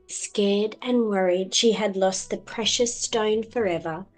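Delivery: usual speaking voice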